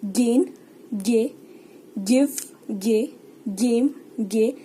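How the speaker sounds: गे drawn out slowly after each word; slow, careful गे sound demo. Shown as a demonstration. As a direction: sound out गे clearly and slowly, like a teacher. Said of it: "हमें तीनों words में ... sound गे की ही आएगी".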